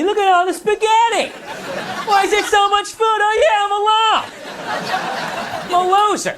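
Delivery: a loser talking to his empty chairs; mock self-pitying whine. Comic mocking himself alone at a table of empty chairs, high whine.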